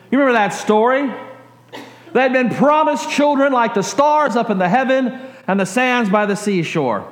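ordinary delivery

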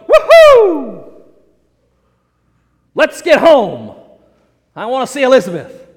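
loud, high whoop easing into eagerness; elated cheer. Acted. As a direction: whoop it like an overjoyed husband. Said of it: "You'd think he'd go".

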